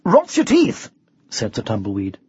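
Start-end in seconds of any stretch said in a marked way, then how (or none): 0.0-0.9 high, brisk bluff; lightly voiced knight; breezy knight. A narrator's reading. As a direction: answer high and airy.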